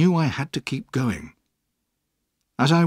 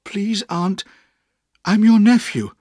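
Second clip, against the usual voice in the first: brave plea; 9 semitones higher, 5 dB louder than usual. A narrator's reading. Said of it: "I said bravely".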